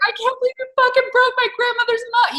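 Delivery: high, loud mock tantrum; imitating her own furious yell at the cat; retold screaming fit. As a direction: shriek it like a past shit fit. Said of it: "like, screaming".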